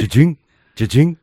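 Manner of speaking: spring each sound upward like a pogo stick; mimicked pogo stick bouncing; imitated bouncing noise. Imitating bounces passing onlookers.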